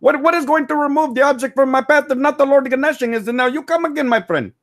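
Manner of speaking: high voice, deadpan and noncommittal; Vishnu voice. Acted. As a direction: play the dry, deadpan shopkeeper. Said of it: "my favorite one to do is Vishnu".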